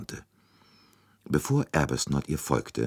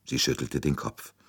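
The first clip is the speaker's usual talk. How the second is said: usual speaking voice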